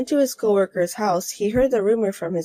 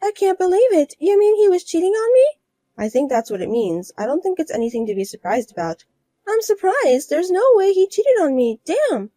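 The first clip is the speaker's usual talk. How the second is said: higher, louder shocked outrage; higher voiced woman learning her husband cheated; betrayed rival wife; 9 semitones higher, 4 dB louder than usual. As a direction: rage in disbelief, higher.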